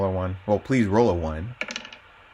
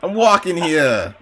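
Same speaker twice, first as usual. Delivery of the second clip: drawn-out, raised New York accent; 5 semitones higher, slower than usual. Shown as a demonstration. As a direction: do the stock New York street line. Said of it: "more of a New York accent".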